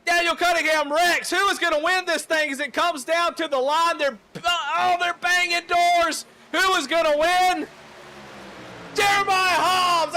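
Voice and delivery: very high, loud yelling call; shouting finish call. Real, not acted. Commentator screaming the wreck and winner at the line.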